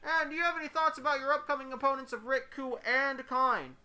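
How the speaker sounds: high-pitched press conference questioner; high put-on voice, slowing on the final name; another audience questioner. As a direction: put on a high voice to ask formally. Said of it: "Someone else raises their hand".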